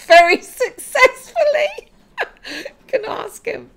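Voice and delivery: high and loud, laughing through the words. Real, not acted.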